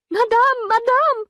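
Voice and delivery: high urgent cry of madame. A narrator's reading. Maid bursting in, crying out to madame. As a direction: servant calling out in alarm to her mistress. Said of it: "cried Félicité".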